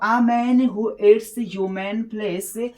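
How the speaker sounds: slow dictation. Shown as a demonstration. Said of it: "teacher reads sentence aloud".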